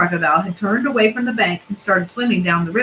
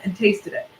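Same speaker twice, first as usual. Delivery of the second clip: usual speaking voice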